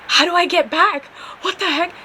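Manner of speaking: high-pitched, mildly panicked questions; retold panic. Stranded driver asking officer the way back, mock panicking. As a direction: act out a rising panic. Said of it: "Dude, I am panicking".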